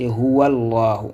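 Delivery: "Huwallahu" slowly joined as one word; liaison. Shown as a demonstration. Teacher shows a new way of reading, "Huwallahu" slowly linked. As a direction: run Huwa into Allahu: "Huwallahu", held L. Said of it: "on peut faire une liaison".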